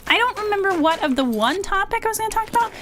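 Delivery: high excited realization; sudden remembering. Real, not acted. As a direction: burst out as it comes back.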